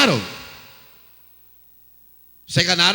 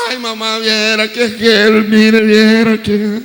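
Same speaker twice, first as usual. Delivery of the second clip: lightly voiced girl begging her mother, louder; a hint of a lovesick girl pleading; pleading daughter; 3 dB louder than usual. La muchacha suplica a la mamá. (muchacha suplicando a su mamá, un poco más fuerte)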